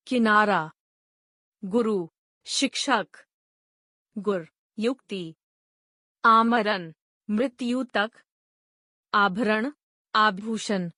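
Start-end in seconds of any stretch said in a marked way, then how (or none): none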